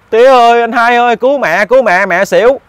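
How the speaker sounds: high-pitched mock yell for help; imitated cry for help. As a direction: call out high as if summoning family to a rescue. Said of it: "cổ la lên là".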